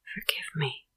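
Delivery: soft remorseful whisper; quietly. Acted. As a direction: breathe it out, full of regret. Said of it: "she said quietly".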